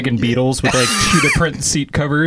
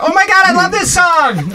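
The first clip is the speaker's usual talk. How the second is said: put-on excited teen girl; 4 dB louder than usual. Mock excited girl quote.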